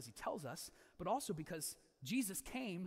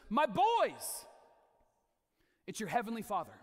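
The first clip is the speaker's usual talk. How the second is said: fatherly call; 3 semitones higher, 7 dB louder than usual. Acted. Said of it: "what does God say to these shepherds".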